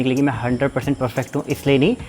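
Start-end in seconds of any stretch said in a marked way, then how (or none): none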